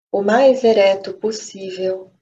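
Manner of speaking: usual speaking voice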